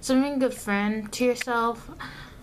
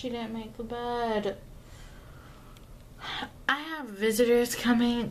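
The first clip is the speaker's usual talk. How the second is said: normal talking voice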